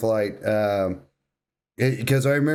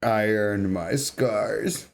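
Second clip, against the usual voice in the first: quoting the hero line; 6 semitones lower, slower than usual. Retold or imitated. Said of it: "dude, so iconic".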